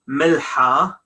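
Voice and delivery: milha with a breathed h, no rasp; soft h. Shown as a demonstration. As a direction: say milha with an airy h in place of kh. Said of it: "Why I did not say".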